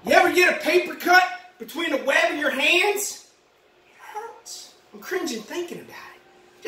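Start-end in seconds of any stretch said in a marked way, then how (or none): 3.9-6.6 whisper. Real, not acted. Wincing about a paper cut.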